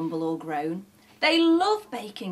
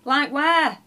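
ordinary delivery, nothing marked

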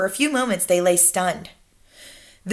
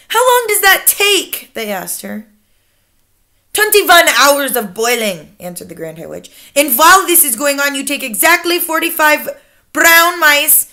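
imperious Grand High Witch; 4 semitones higher, 5 dB louder than usual. Acted. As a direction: lecture your followers with icy authority.

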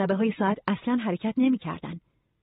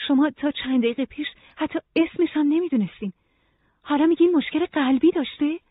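higher, incredulous challenge; disbelieving; 8 semitones higher than usual. A narrator's reading. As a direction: question it in raised-pitch disbelief. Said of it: "نورا ناباورانه گفت". (با ناباوری و صدای زیر)

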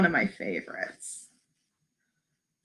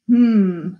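ordinary delivery

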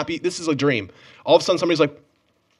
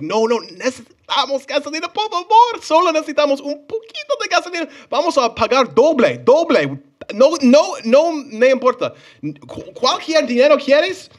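desperate begging; 12 semitones higher than usual. Retold or imitated. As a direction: beg like the tank is empty. Retold desperate begging in Spanish.

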